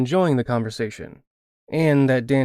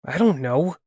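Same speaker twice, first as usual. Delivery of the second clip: plain reading voice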